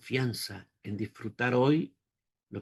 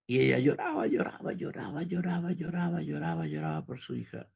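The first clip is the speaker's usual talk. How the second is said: imitating weeping; 5 semitones higher than usual. Mother weeping while he prays.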